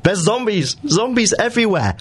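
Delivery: high-pitched panicked cry. Retold or imitated. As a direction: shriek it like a spooked medic. Imagined ambulance crew panicking.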